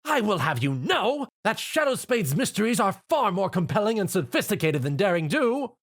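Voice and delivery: high, haughty lady voice; indignant Rarity. A narrator's reading. Rarity defends Shadow Spade to Rainbow, high and haughty. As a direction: defend your taste with lofty pride.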